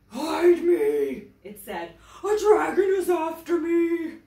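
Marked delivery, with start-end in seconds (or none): 0.1-1.3 loud, high lion shout; panicked lion. A narrator's reading. Frightened lion bursts in. 2.2-4.2 loud, high lion shout; panicked lion. A narrator's reading. Frightened lion flees dragon.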